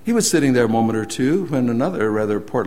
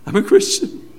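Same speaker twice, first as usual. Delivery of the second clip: overjoyed; 12 semitones higher, 4 dB louder than usual. Retold or imitated. Convert repeating joyful confession.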